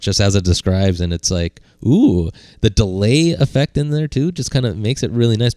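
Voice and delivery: ordinary talk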